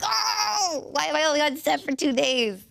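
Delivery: high, loud cartoonish wail; exaggerated high-pitched lament; mock dismay. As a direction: play up the comic despair. Acts out own dismayed reaction.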